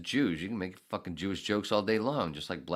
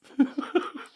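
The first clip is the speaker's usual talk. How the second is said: incredulous high laugh; 6 dB louder than usual. Real, not acted. Scoffing at accusation.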